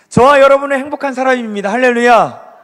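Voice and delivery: fast, loud rising cry of joy; excited preaching shout. Real, not acted. Preacher rousing the congregation, a loud joyful shout. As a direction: lift the room with a hallelujah.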